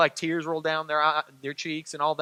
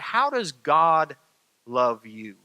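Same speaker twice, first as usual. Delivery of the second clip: tender question; 4 semitones lower, slower than usual. Real, not acted. Preacher turns tender question.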